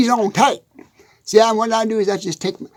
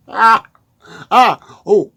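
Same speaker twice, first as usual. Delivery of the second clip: ordinary delivery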